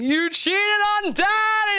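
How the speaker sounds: high, drawn-out dramatic accusation; shocked accusation. Retold or imitated. Comic playing herself accusing mom, high and slow. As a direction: gasp it out like a soap opera. Imagined accusation at wax center.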